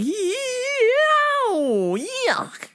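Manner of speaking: very high, long-held wail of revulsion; hammed-up disgust. Worker hearing about kid touchers, disgusted wail. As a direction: squeal it out, stretched and revolted.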